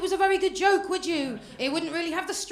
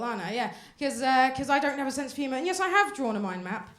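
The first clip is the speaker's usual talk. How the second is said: ordinary talk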